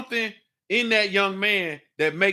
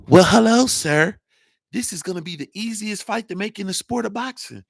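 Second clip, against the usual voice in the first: the same timid greeting thrown out much louder; louder mocking impression; 5 dB louder than usual. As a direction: ham up the meek boxer's greeting loudly. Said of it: "Shut that soft shit up".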